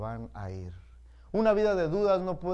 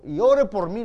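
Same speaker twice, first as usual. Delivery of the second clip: pleading; 5 semitones higher, 11 dB louder than usual. Retold or imitated. Sister begs pastor to pray.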